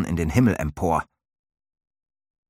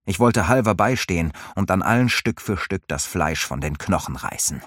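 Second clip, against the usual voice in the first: plain reading voice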